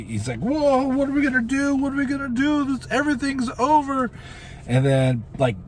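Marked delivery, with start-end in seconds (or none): 0.4-4.1 very high mock-panicked wail; hammed-up squeaky despair, imitating a hero losing it; freaking out. Hero freaking out after Earth blows up, high mock panic. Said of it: "Cap is just freaking out".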